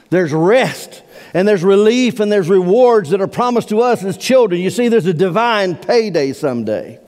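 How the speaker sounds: high, loud pulpit preaching, pressing hard; fervent preacher at full cry; full-force preaching. Acted. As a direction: drive the scripture home from the pulpit.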